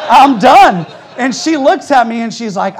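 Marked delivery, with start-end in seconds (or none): 0.1-0.9 very loud, raised comic declaration; mock panicked shout. Repairman wanting out of the job, mock panicked shout. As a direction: belt it out for the laugh.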